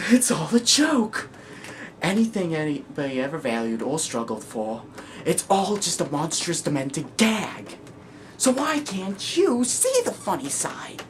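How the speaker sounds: high, drawn-out manic plea; Joker. Acted. Joker urging you to see the funny side, manic. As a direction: stretch the key words, half crazed.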